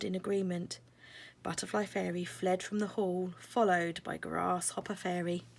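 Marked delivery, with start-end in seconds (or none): none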